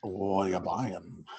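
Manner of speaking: slow, hint of the shady merchant; sly mysterious shopkeeper. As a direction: drawl it like a shady trader. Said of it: "Resi four merchant voice".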